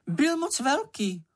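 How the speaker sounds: high, drawn-out little character voice; unabashed. A narrator's reading. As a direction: answer without ceremony, slow and high-pitched. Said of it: "odpověděl Čenich bez okolku".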